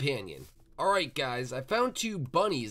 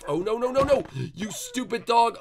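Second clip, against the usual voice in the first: exasperated scolding; 8 semitones higher, 6 dB louder than usual. Real, not acted. Dog ruins things in game.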